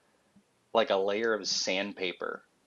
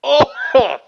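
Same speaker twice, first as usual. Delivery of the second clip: sour-faced squeal; 12 dB louder than usual. Real, not acted. Sour candy reaction.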